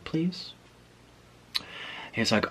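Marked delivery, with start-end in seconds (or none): 0.0-0.5 small, soft, high plea; puppy-eyed pleading. Retold or imitated. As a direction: plead meekly with a rising tone. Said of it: "sad puppy eyes".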